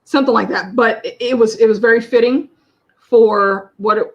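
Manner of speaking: ordinary talk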